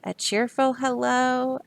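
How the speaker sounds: plain reading voice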